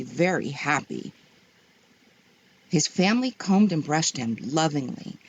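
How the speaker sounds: ordinary delivery, nothing marked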